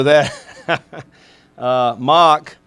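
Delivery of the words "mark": Mock said with a short open Australian vowel and no r, a rough attempt; a quick, self-admittedly bad Australian Mock; Australian accent. Coach imitating his Australian punter saying his name Mock. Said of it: "That's a terrible Australian accent".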